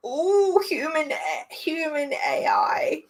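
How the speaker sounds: high, drawn-out fascinated ooh; real fan excitement; excited cooing. Real, not acted. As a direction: savour it in a high, delighted pitch. Thrilled reaction to the synopsis.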